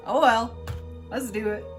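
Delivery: usual speaking voice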